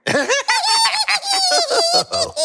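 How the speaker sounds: long high giggling laugh; joining in laughter. Acted. Groot joining Rocket's scheming, long high giggle. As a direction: giggle along, delighted. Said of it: "who joins in on the laughter".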